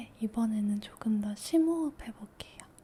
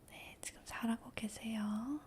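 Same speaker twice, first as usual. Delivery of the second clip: ordinary delivery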